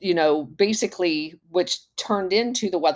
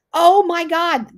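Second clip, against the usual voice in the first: high, loud, slow gasp of disbelief; shocked; 12 semitones higher, 6 dB louder, slower than usual. Real, not acted. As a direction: let the shock spill out. Shocked by search result.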